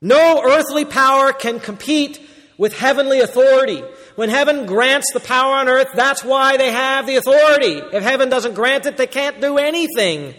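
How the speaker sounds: preaching at full force. Acted. Preacher thundering to his congregation about heaven's rule. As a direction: proclaim it over the congregation.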